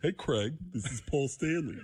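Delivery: lightly voiced rock-star phone call; a hint of a singer impression. Singer phoning the comic, light impression. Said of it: "can you just recreate the phone call".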